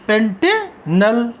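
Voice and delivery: Pantanal drawn out, paused before नल; slow dictation. Shown as a demonstration. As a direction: split Pantanal slowly into पेंटे and नल. Teacher dictates name in parts.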